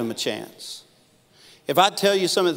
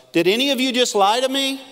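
much higher, louder probing questions; Wesley questioning; 11 semitones higher, 5 dB louder than usual. Retold or imitated. John Wesley grilling his men about money, high and loud. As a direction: grill them like a stern mentor. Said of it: "The last one was".

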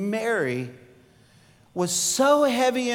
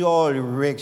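Cajun mama; 6 semitones lower than usual. Retold or imitated. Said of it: "real thick Cajun accent".

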